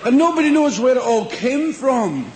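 ordinary delivery

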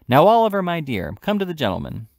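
loud, high false sweetness; said aloud. A narrator's reading. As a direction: call out sweetly for the magistrates' ears. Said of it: "and said aloud".

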